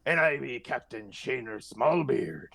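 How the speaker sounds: gruff pirate captain voice. Acted. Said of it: "I'm talking like a pirate".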